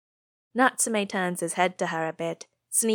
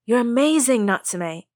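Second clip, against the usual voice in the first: gushing; 5 semitones higher, 6 dB louder than usual. A narrator's reading. Friends gushing over Natsume.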